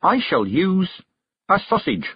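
high, slow proclamation; grand announcement. A narrator's reading. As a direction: pause for effect before the reveal.

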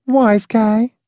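put-on high, sniffy girl voice. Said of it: "the girl sniffed".

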